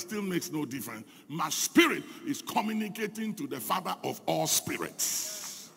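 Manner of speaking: usual speaking voice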